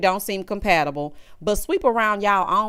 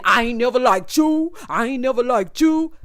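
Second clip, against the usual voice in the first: mocking him; 3 semitones higher, 3 dB louder than usual. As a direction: ham up his line to ridicule it. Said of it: "Not you coming in".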